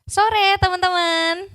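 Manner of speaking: high, drawn-out greeting to a crowd; sapaan panggung. Real, not acted. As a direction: call out to the audience in a high, sustained voice. Calling out a greeting to audience. (menyapa penonton dengan nada tinggi memanjang)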